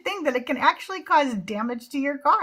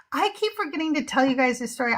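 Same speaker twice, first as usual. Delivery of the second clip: usual speaking voice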